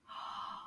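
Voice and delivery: a quiet mock gasp of discovery; soft surprised gasp. Teacher gasps to show kids the rhymes, soft. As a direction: gasp softly as if spotting something.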